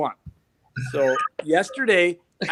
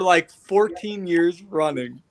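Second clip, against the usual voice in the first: slow boast; slower than usual. Real, not acted. Proud boast.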